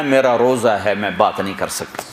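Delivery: usual speaking voice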